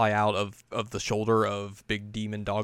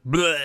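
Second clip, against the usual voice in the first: loud, higher "bleh" noise; Sesshomaru spewing goo; 7 semitones higher, 6 dB louder than usual. Retold or imitated. Host imitating Sesshomaru sending out goo, loud spewing noise. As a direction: spew out a rolling bleh. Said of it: "Sesshomaru is like".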